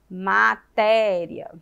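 "Matéria" slowly split, ma, té-ria; syllable by syllable. Shown as a demonstration. Teacher showing children how to separate syllables, slowly split. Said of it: "Separar a sílaba".

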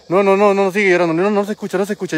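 normal talking voice

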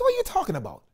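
to his Rasta brother, high and incredulous. Retold or imitated. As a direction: throw it back in disbelief. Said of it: "I said".

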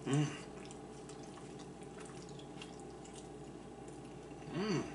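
biting and chewing; talking through food; mouth full of pizza. Real, not acted. Seen 0: Takes a big bite at 1.3s, mouth full through the final "Mm.

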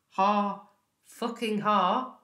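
dry, spoken mock laugh; bitterly sarcastic. Speaker scorns the directors' promised plans, bitterly sarcastic. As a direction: spit out a humourless fake laugh.

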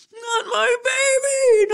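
high, wailing cry, drawn out slowly. Retold or imitated. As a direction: let the grief break through the words. Mother overwhelmed while packing.

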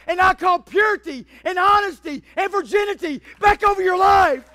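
high, shouted revival exhortation; preaching at full force. Real, not acted. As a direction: build to a roaring plea to the church.

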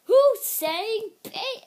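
loud, high, slow demand; hammed-up outrage. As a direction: holler it, stretching the words. Pig yells at the bacon teaser.

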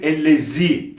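"Ellezî" with a plain buzzing z; sharp Z. Shown as a demonstration. Quran teacher showing what to avoid, sharp z. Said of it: "diyerek geçmiyoruz kesinlikle".

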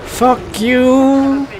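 high, drawn-out curse; exasperated cursing. Real, not acted. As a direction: fling the curse out, voice way up. Cursing the game while fleeing.

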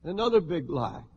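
normal talking voice

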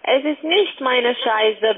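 ordinary talk

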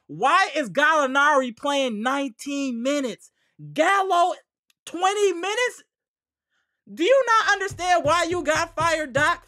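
high, loud disbelief at the coach; exasperated rant. Real, not acted. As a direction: rail at the coach, pitch and volume climbing. Exasperated rant about coach's rotations.

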